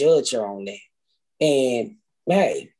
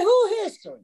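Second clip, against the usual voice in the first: much higher, baffled little question; confused squeak. Retold or imitated. Retelling her naive question to her husband, high and baffled. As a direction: play it clueless and high-pitched.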